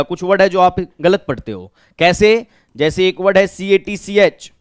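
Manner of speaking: ordinary talk, nothing marked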